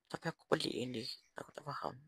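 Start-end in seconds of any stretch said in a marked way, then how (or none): 1.4-2.0 whisper. Real, not acted. Puzzled aside.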